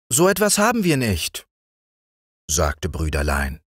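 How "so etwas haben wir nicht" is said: hoch und zügig wie ein kleiner Junge; Jungenstimme. A narrator's reading. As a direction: eifrig erklären wie ein Kind. (Brüderlein erklärt dem Zimmermann schnell und hoch)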